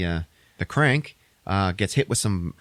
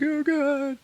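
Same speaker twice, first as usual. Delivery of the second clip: very high, wailing woman voice; hammed-up dread; mock despair. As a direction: imitate a frightened whimper in falsetto. Panicking woman in retold film.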